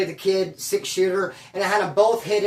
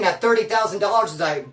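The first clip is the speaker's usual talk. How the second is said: normal talking voice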